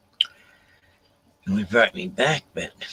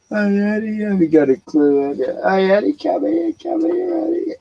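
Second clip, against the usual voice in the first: very high sing-song call; high coaxing pet call; calling the dog; 10 semitones higher, 3 dB louder than usual. Real, not acted. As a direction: coax the dog over sweetly.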